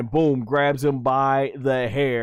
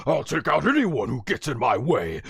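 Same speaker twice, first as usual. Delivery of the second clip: Vegeta. Retold or imitated. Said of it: "He says".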